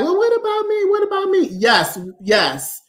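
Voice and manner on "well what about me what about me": high, whiny other women asking; mock plea in a high voice; whiny imitation. Imitating other women who feel left out, high and whiny. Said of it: "other women are like".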